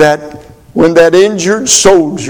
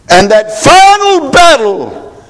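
high preached shout; preaching at full force; 12 semitones higher, 4 dB louder than usual. Acted. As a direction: shout it out from the pulpit. Preaching climax.

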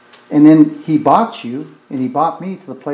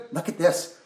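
normal talking voice

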